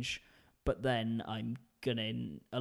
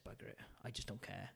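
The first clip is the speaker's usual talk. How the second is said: muttered resignation; 3 semitones lower, 15 dB quieter, faster than usual. Retold or imitated. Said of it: "I just said".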